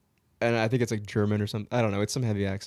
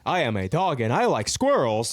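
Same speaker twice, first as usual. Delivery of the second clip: earnest cartoon talking-dog voice; Doug; 3 dB louder than usual. Talking dog introducing himself through buttons, simple dog voice. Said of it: "It'd be Doug from Up".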